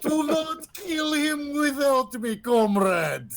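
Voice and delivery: high-pitched hammed-up wail; theatrical high cry to a comrade; mock screaming. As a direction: wail it high, falling at the end. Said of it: "will be screaming".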